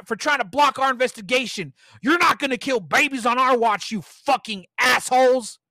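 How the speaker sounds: loud, high raging rant; angry tirade. Acted. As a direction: rant at her, full fury. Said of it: "He went on a complete tirade".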